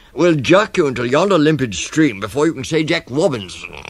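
loud, high, pompous declaration; python rock snake. Acted. Snake warns the child of the crocodile, loud and pompous. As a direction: proclaim the long-winded warning loudly. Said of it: "This is the way bicoloured python rock snakes always talk".

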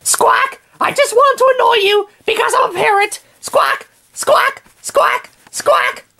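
very high, squawking parrot talk. Acted. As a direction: screech it like a pesky bird. Parrot Paco annoying sleeper.

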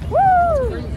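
high, loud whoop; excited whoop. Real, not acted. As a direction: let out an excited cheer. Excited cheer in New York.

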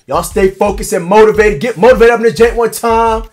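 motivational shout. Pretend. Drill sergeant signing off to viewers, rallying shout.

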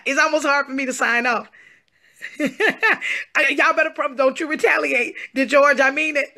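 bubbling over with joy; excited giddy. Real, not acted.

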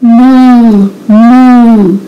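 long, drawn-out spoken moo; cow moo. A narrator's reading. Cow calling to the spider, long mooing. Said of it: "said the cow".

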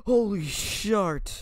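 high, slow drawn-out groan; overwhelmed disgust. Real, not acted. Host reeling after Vegemite, high drawn-out groan. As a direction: stretch it out, still reeling from the taste. Recovering from the awful taste.

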